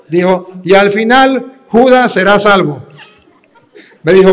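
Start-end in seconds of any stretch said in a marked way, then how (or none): 0.6-2.8 "Judas" stressed on JU, loud and higher; uncle's misreading. Retold or imitated. Uncle reading his proof to the priest, loud confident misreading. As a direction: read it out loud and sure, "Judas" with stress on the first syllable. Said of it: "Ahí viene cómo me lo leyó mi tío".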